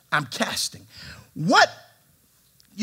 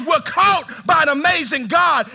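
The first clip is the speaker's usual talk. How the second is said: slow, loud, higher proclamation; preaching shout; 9 semitones higher, 5 dB louder, slower than usual. Real, not acted. Preacher proclaiming to the congregation, loud and slow. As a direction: shout it out to the church. Preacher building to full force.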